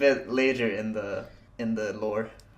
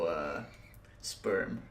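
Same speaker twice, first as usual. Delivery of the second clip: whisper; 6 semitones lower, 9 dB quieter than usual. Real, not acted. Whispered punchline.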